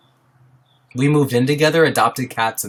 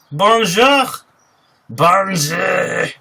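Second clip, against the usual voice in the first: lightly put-on French accent, higher; 8 semitones higher, slower than usual. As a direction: try out a French accent, playful. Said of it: "I'm not good at the accent".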